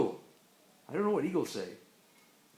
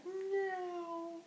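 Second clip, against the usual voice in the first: high, softer drawn-out meow; cat meow; 9 semitones higher, 8 dB quieter than usual. Pretend. Parent answering "that's a cat", high soft meow. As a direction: mew like a cat on a high note.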